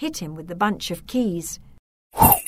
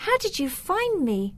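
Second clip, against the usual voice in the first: put-on high girl's voice; 11 semitones higher than usual. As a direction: ask in a young girl's voice. Girl voiced in story.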